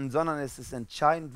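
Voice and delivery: normal talking voice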